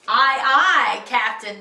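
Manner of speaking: loud, smart sailor reply; crew's salute. Acted. Toadlets replying to their captain, loud salute. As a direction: answer the captain loudly.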